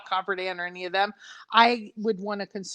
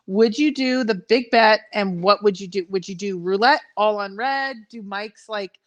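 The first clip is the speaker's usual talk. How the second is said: ordinary delivery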